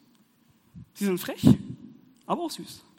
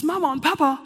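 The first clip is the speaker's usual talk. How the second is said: put-on child calling; 7 semitones higher, 8 dB louder than usual. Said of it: "dann ruft es".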